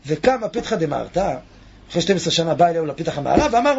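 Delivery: usual speaking voice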